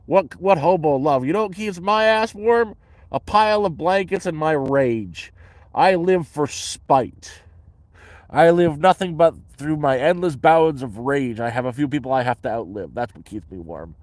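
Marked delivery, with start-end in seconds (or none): 0.1-11.4 higher, louder mock rant; mock rage. Answering a question about hobo love, mock-enraged. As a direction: rant with hammed-up spite.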